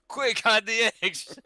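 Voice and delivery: high, loud, slow mock alarm; panicked disciple. Joking about hiding eggs from Jesus, high slow mock alarm. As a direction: shout the warning like a panicked disciple.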